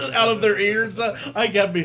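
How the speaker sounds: laughing while talking. Real, not acted. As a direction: get the idea out through your own laughter. Laughs at own gag idea.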